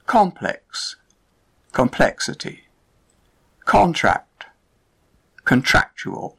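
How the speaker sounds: COMplex then comPLEXity, CONtract then conTRACtual; stress shift demo. Shown as a demonstration. Teacher contrasting word pairs for learners, stress shifting. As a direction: move the stress back one syllable. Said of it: "the stress moves to the second syllable".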